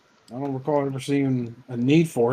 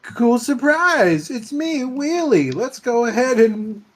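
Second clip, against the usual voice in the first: high, slow, sugary creepy voice; sweet creepy coaxing; 10 semitones higher, much slower than usual. Acted. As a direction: lure them in, sickly sweet and high. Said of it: "you flip open the visor".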